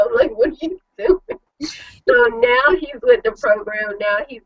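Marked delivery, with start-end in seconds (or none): 0.4-1.4 laughing disbelief. Retold or imitated. Scolding husband, laughing.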